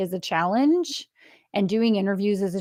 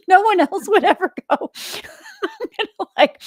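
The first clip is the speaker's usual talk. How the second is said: laughing; 11 semitones higher, 4 dB louder than usual. Real, not acted. Laughing while telling story.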